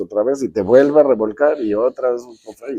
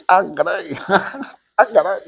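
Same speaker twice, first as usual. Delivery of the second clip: amused mock surprise, pitched higher; a light laughing gasp of surprise; retold surprise; 7 semitones higher than usual. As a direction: caught off guard and laughing. Speaker reacts to bikini news.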